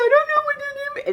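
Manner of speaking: high whimpering mock cry; mocking the girlfriend's teary helplessness; mock whiny girlfriend. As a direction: whine it like she's about to cry. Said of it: "then she wants to cry".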